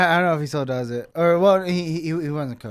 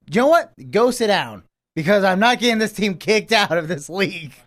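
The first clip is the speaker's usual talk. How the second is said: high, loud urgent scolding; firm retold warning; 5 semitones higher, 6 dB louder than usual. Assistant coach pulls the hothead aside, loud and urgent. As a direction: coach grabbing him to calm him.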